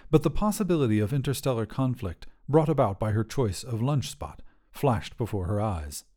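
plain reading voice

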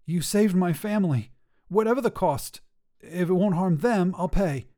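a small winged gargoyle vowing with all his heart; grateful gargoyle. Acted. Longtooth swearing to Cesara he will pay any cost, earnest and eager.

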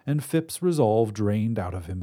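ordinary delivery

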